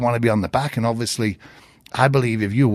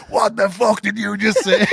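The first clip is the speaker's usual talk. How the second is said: high, mock outraged demand; retold angry challenge; 10 semitones higher than usual. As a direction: hurl it back like an offended old coach. Billy snaps at the visitor.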